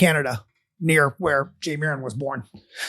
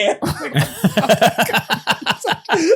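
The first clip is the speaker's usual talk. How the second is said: laughter; 4 semitones higher than usual. Real, not acted. Laughing at the prank.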